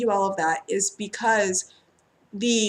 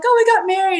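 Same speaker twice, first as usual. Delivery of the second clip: higher and louder, bubbling news; excited client; 10 semitones higher, 8 dB louder, faster than usual. Retold or imitated. Client tells the reader the happy news, excited and high. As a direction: announce it like thrilled good news. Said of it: "people come to me and they're like".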